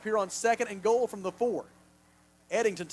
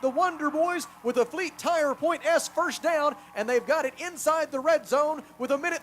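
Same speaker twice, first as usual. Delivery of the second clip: excited play-by-play; 7 semitones higher, 3 dB louder than usual. Real, not acted. Play-by-play of a deep completion.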